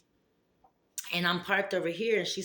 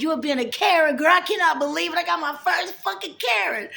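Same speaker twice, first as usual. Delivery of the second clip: much higher and louder, triumphant; loud gleeful crowing; 10 semitones higher, 6 dB louder than usual. Retold or imitated. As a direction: shout the joy of a first Karen. Retold gleeful crowing at Karen.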